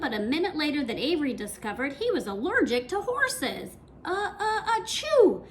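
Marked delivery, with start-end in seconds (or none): 4.0-5.4 high acted sneeze build-up; a child sneezing, uh uh achoo. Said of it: "sneezed Avery".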